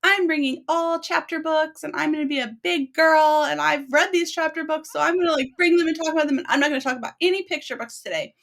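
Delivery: put-on high, eager big-girl voice; a proud little kid bragging; mock big-girl boast, pitched high; imitating her overconfident past self. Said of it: "I was like".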